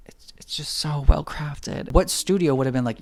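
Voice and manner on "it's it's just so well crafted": hushed, slow and breathless with wonder; slow awestruck whisper; awed whisper. Real, not acted. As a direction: whisper it like you're in a cathedral. Awed by the beautiful chamber.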